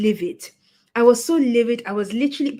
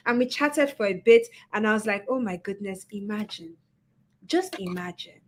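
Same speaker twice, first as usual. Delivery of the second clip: ordinary talk, nothing marked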